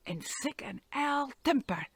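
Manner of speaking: ordinary delivery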